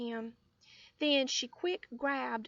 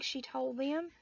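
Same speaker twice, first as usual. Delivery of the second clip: plain reading voice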